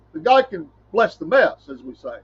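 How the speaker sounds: ordinary delivery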